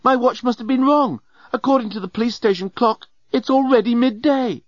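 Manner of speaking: high, dismayed announcement; urgent announcement. A narrator's reading. Nettie at the cell door announcing lateness, dismayed. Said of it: "she announced".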